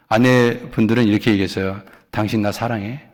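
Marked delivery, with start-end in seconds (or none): 2.1-3.1 soft, quiet asking; wife softly asking if he loves her; tender question. Pretend. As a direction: ask it gently to the angry husband. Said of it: "물어보시라니까".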